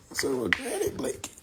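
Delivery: breathy unvoiced whisper; whispered repeat. Real, not acted. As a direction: whisper it to yourself.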